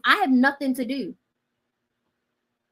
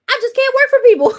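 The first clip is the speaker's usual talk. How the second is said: louder and higher, bursting into laughter; a loud, high laugh at your own joke; 11 dB louder than usual. Real, not acted. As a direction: laugh it out at yourself. Laughing at herself.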